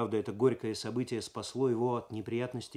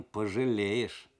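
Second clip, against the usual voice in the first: slow, drawn-out mild taunt; a touch of sly mockery, stretched out; slightly mocking; much slower than usual. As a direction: let the last word carry a hint of mockery. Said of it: "сказал чуть насмешливо".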